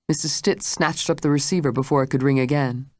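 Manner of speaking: ordinary delivery, nothing marked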